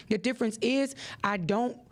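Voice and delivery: ordinary delivery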